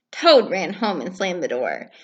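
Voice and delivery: ordinary delivery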